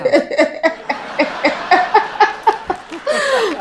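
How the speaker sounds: long high-pitched laughing fit; helpless laughter. Real, not acted. Reacting to the comedian's joke.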